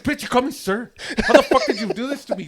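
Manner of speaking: high, loud retold tirade; indignant outburst. Customer's reply to the barber asking his opinion, indignant. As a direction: burst out at full tilt, affronted.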